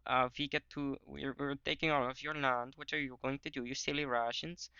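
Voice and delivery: hammed-up German gloating; German accent. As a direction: taunt in a put-on German accent. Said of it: "I'm just doing a German accent".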